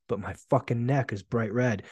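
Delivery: usual speaking voice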